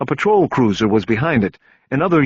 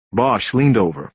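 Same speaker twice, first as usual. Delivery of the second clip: ordinary delivery, nothing marked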